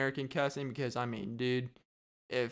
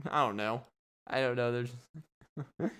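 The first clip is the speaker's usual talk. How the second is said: ordinary talk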